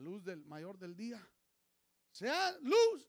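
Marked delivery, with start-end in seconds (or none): 2.1-3.1 booming, high, far louder "Sea luz"; voice of God. Acted. Preacher booms God's command to the congregation. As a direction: proclaim it like God speaking creation into being.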